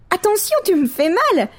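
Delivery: put-on high child's voice, cheeky then pleased; a boy rubbing his head, then happy with the nuts; little boy. Boy talking up to the crow, high childish voice.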